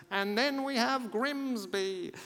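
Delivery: slow, high drawn-out announcer call; stadium announcer. Retold or imitated. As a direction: announce the underdog team with mock pomp. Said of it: "I don't know if he had a voice like that".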